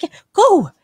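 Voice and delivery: ordinary talk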